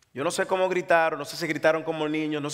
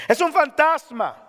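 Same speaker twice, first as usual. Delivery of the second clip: drawn-out frightened cry, pitched high; slowed, high frightened exclamation; exclaimed; 8 semitones higher, 5 dB louder, slower than usual. Acted. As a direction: give it the full exclamation mark. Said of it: "una exclamación".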